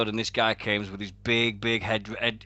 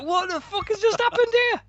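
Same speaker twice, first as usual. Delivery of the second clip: shocked; 3 dB louder than usual. Retold or imitated. Retold shocked texting.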